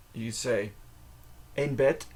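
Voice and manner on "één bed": bed ending in a T, "bet". Shown as a demonstration. Teacher models Dutch for one bed, D as T. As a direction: close bed on a crisp T. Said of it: "the D turns into a T".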